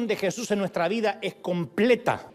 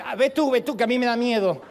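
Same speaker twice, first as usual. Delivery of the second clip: high, louder mock panic; scared wife; 5 semitones higher, 3 dB louder than usual. Wife sending husband after the rat, mock-scared. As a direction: push him toward the rat, squealing. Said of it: "tu mujer te dice".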